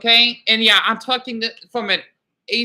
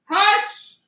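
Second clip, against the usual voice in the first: high, loud hush! cut in mid-story; sharp hush; 10 semitones higher, 3 dB louder than usual. Real, not acted. Breaking off her story to hush the dogs. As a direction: snap at the dogs to stop.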